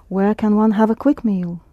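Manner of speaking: ordinary talk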